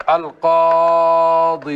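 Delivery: القاضي drawn out slowly as "al-qaaḍii" with a long final ii; slow demo of القاضي keeping a long ii; pausal form keeping the ya. Shown as a demonstration. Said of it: "نقف عليه بإثبات يائه".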